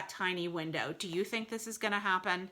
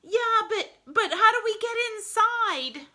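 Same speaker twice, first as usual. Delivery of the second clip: much higher, anxious little voice; nervous high-pitched fish; worried small creature asking; worried sidekick; 8 dB louder than usual. Acted. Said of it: "they sound very worried".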